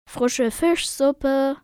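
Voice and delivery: loud, slow announcement; calling out. Acted. Fisherman coming from the galley with soup, calling out. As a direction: call it out, drawing the words long.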